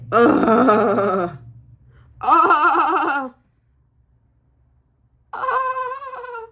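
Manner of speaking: high delighted squeals; excited squeals. Real, not acted. To viewers, squealing with delight over a palette.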